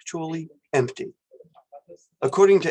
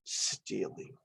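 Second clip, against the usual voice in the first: whispered, softer, with disapproval; dismissive disappointed tone; 7 semitones lower, 13 dB quieter than usual. Shown as a demonstration. Professor showing a disappointed tone toward stealing. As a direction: breathe the word out in dismay. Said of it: "equivalent to something like this".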